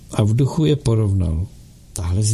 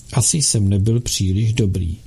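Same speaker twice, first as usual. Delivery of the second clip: ordinary delivery, nothing marked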